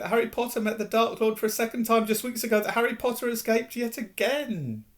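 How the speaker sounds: a humble, adoring little creature; house elf voice. A narrator's reading. Dobby's voice.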